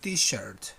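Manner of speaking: T-shirt said slowly and low, a clear teacher's model; T-shirt, with the final T sounded; model pronunciation. Shown as a demonstration. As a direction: say T-shirt clearly for them to copy. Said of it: "repeat after me first".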